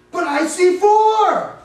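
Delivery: high, loud, slow shocked cry on four; king seeing a fourth figure in the fire, loud and stunned. Acted. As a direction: shout it out in disbelief. King shouts seeing fourth man.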